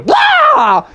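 very high loud startled yelp; high startled shout; startled wake shout. Acted. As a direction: jolt awake with a yell. Junior startled awake.

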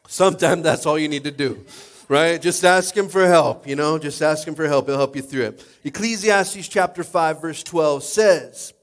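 preaching. Acted. Pastor urging the congregation to ask God for help, preaching loudly. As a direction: preach it out with full force.

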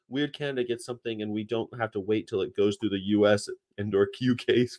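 ordinary talk, nothing marked

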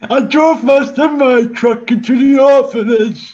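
put-on loud old Geordie dad, shouting; Geordie old father. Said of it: "your father, Geordie Geriatric".

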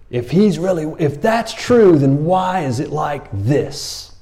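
incredulous. Retold or imitated. Gideon's doubting challenge.

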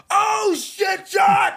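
very high, loud shout; starstruck outburst. Retold or imitated. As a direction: snap out of a daze and yell. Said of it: "I snap out and go".

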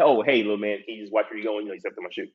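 polite request. Retold or imitated. Polite first request to kid.

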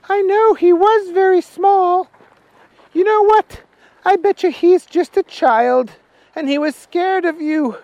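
high, loud voice for Agnes. Acted. Agnes answering Archie about the small fella, high voice.

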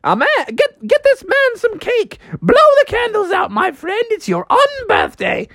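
very high, exuberant party host; Mad Hatter. Acted. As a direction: celebrate madly in a high cartoon voice. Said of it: "the Mad Hatter was like".

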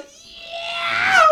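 high excited yell; hype shout. Acted. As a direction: shout it to pump the crowd. Hyped host intro.

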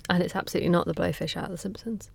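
quick, soft whispered correction; whispered aside. Real, not acted. Aside correcting her own joke.

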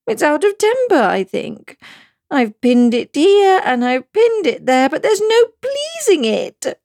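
high, slow, plaintive fretting; mild tearful complaint; melancholy. Acted. Queen lamenting her shawl to Alice. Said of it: "in a melancholy voice".

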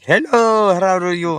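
high-pitched caller in a mock Indian accent; imitated Indian accent. Imitating the caller's greeting, put-on Indian accent. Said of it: "call in with the Indian accent".

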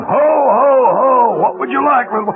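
loud, jolly Santa voice. Acted. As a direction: sell the football like a hearty Santa. Santa pushing a football.

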